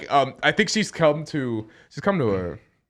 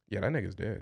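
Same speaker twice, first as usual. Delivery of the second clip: low and much softer; hushed deadpan aside; 7 semitones lower, 12 dB quieter, faster than usual. Acted. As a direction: murmur it discreetly over the body. Retells remark over unconscious fighter.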